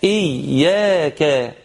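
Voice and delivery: إياك with the "yy" held firmly; doubled ya. Shown as a demonstration. Teacher stresses the shadda, إياك with doubled ya. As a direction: lean on the doubled y in إياك, slowly. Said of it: "الياء مشددة".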